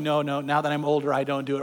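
low, subdued mock denial; older objector. Older women in church denying it, voiced low and subdued. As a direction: drop it low, imitating a demure objector. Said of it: "some of you are going".

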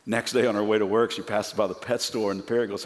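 usual speaking voice

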